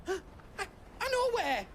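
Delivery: sharp gasp, then a high eager burst; sudden realisation gasp. Acted. Goetz remembering a friend, sharp gasp then eager cry. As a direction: catch your breath as the answer hits you.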